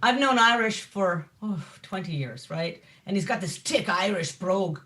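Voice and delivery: usual speaking voice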